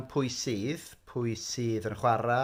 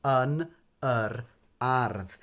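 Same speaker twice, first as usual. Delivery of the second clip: slow careful model phrase; much slower than usual. Shown as a demonstration. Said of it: "it can be a little bit tricky to say that".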